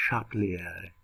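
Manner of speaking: "Châtelier" said the French way, shat-lee-ay; French pronunciation. Shown as a demonstration. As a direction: give "Châtelier" its French sound. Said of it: "you're gonna hear it the right way according to the true French language".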